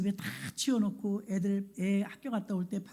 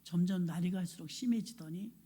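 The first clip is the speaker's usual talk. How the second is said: normal talking voice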